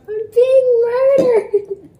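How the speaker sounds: high, slow mock wail; dramatic wail. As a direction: wail it like a hammy victim. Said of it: "If you remember that video".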